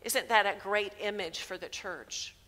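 usual speaking voice

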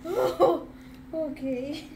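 usual speaking voice

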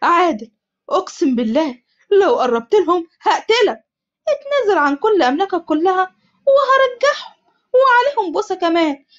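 غاضب; angry. Acted. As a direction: swear revenge through anger. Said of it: "عمر بغضب".

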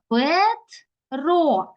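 "Петро" slowly as two pieces, Пет... ро; split into syllables. Shown as a demonstration. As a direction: pause in "Петро" between Пет and ро. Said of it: "Два склади можемо поділити це слово".

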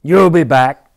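loud, angry shout of a cat; stormy cat. Acted. The cat storms at the duck walking out. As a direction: hurl it after the departing duck. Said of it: "stormed the cat".

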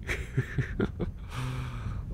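short bashful laugh; soft laugh. Acted. Butler hugged by the listener, laughing shyly. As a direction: let a shy giggle escape.

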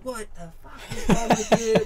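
imitating a furious father's yell; retold telling-off. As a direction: tear into him like an angry dad, half laughing. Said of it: "he just lashed into Hughes".

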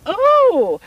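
high, loud startled oh; a sudden jump of surprise; surprised oh. Acted. Queen startled by the dairymaid's marmalade idea, high surprised oh.

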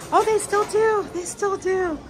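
high, loud squeal of delight; excited discovery. Real, not acted. Fan spots film detail still there, excited. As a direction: burst out high and thrilled.

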